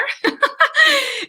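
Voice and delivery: a high bright burst of laughter; short high giggle. Real, not acted. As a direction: laugh at yourself on screen. Laughing at own screen.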